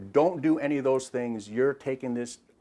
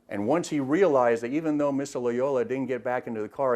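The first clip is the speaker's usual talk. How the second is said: ordinary talk, nothing marked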